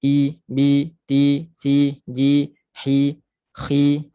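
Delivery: I, bi, ti, si, ji, hi, khi with lips pulled down; I, bi, ti, si, ji, hi, khi with lips drawn downward. Shown as a demonstration. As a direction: recite I, bi, ti, si, ji, hi, khi, lips moving down. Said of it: "gerakkan bibir ke bawah".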